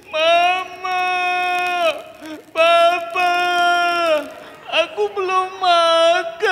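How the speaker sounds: put-on high, loud, slow wailing child's plea; crying bear cub. Lost bear cub wailing for his parents, loud and high. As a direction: wail for mama and papa. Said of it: "Dia nangis lagi".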